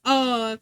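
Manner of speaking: "Uh" as an open vowel with no R; non-rhotic "Uh", R left off. Retold or imitated. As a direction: imitate a friend dropping the R into "Uh". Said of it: "They, they always go".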